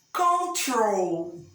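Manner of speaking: "Control" drawn out, con-TROL; stress on last syllable. Shown as a demonstration. Teacher showing pupils the strong ending, stress on last syllable. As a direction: stretch "Control" out, weight on TROL. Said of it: "o final é forte".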